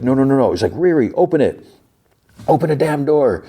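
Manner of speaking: high, pleading cries of no; desperate pleading. Acted. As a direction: beg through the locked door in panic. John trapped behind sealing doors.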